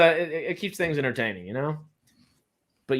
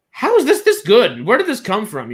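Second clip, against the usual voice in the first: loud, high-pitched astonishment; hammed-up amazed viewer reaction; amazed disbelief; 9 semitones higher, 10 dB louder than usual. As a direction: burst out as if blown away. Acting out amazed film viewer.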